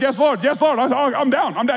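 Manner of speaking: high, loud hurried yes Lord; mock surrender. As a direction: give in fast, hammed-up. He submits to God.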